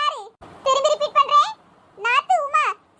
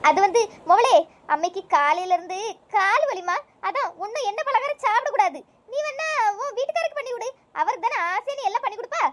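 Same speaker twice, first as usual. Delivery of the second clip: put-on old mother; 6 semitones lower than usual. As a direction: play the old mother, wrapped up in her songs. Old mother dodging the vada. (பழைய பாட்டில் மயங்கும் வயதான அம்மா குரல்)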